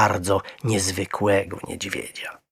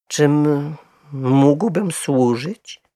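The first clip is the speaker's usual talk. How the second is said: higher, slow, courteous question; polite bear; 7 semitones higher, 4 dB louder, much slower than usual. A narrator's reading. Little bear to the staring couple, politely inquiring. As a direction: offer help with drawn-out formality. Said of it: "pytającym spojrzeniem".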